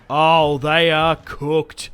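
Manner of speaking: slow, loud, drawn-out gloat; real triumphant crowing; gleeful gloat. Real, not acted. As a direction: savour the enemy's collapse. Gloating over the routed enemy.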